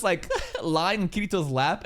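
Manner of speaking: ordinary delivery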